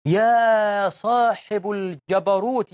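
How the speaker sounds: very drawn-out invocation; slow, sustained plea; supplication. Shown as a demonstration. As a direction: call on God, stretching each word slowly. Demonstrates a supplication to God.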